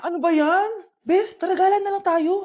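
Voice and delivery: high, loud complaint; exasperated groaning. Acted. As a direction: gripe loudly to a best friend. Student annoyed by assigned activity. (malakas na pagrereklamo)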